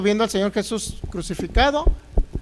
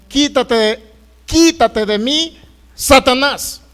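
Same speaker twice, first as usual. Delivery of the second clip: loud, raised-pitch command, dragging out the repeat; stern rebuke; 4 semitones higher, 6 dB louder, much slower than usual. Acted. The Lord turning on Peter to rebuke him, stern and loud. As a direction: drive him away with a forceful, drawn-out order.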